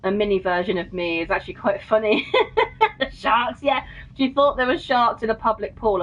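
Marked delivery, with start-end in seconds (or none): 2.3-3.1 a very high laughing squeal; high burst of laughter. Real, not acted. Laughing at the memory.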